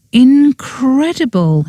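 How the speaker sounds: high, slow awed praise; blissful. Acted. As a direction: melt into the word. Tasting the cake.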